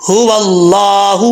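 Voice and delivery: هو اللہ slowly, ه from the chest; slow chant of هو اللہ with a full, chest-voiced ه; tajweed recitation. Shown as a demonstration. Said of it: "بھاری آواز میں پڑھا جائے گا".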